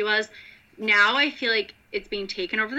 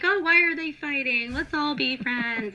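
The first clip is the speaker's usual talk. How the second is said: mock peacemaker; 6 semitones higher than usual. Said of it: "telling, like".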